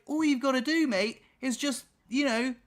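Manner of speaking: high, pressed plea; pleading. Retold or imitated. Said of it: "I was like".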